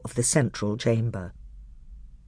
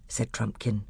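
ordinary delivery

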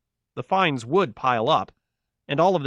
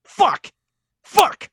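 loud, high screamed curse; furious yell; 6 dB louder than usual. A narrator's reading. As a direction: explode in rage, louder and higher. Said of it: "he yelled".

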